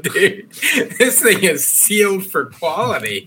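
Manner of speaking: laughing through the words; giggling, can't stop. Real, not acted. Laughs while failing with jar.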